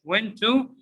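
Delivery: "went to" with full "too", asked; two clear words, rising "went to"; unreduced wrong example. Shown as a demonstration. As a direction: question the full-form "went to".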